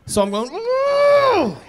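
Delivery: long high strangled squeal; a trapped sleeper trying to cry out; nightmare squeal. Retold or imitated. Mimicking muffled nightmare cry.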